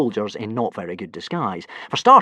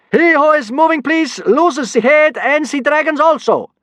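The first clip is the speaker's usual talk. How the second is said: very high, loud broken-language threat; yelling in bad Norse; 7 dB louder than usual. Kidnapper threatening the class, very high and loud. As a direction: yell clumsy orders at the class. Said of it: "yelled the first kidnapper, speaking very badly in Norse".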